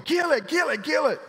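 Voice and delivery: high, loud panicked mock shriek; imitating wife yelling. Preacher imitating his wife Kim shrieking at the snake, high mock panic. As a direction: squeal it like a frightened wife. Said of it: "she's going".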